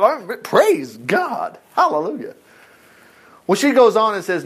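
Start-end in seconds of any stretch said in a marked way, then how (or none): 0.0-2.3 slow praise. Real, not acted. Preacher praising.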